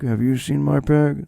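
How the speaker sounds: understated movie loner impression; underplay the question like an intense actor; Nick Cage. Man in the forest seeking his pig, understated impression. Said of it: "That's my Nick Cage impression".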